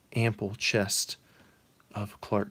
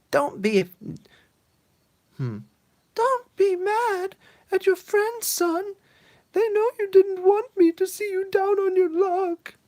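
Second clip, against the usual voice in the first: put-on quivery old mother; 7 dB louder than usual. Mother comforts her son, high quavering old voice. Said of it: "she's got a quivery voice".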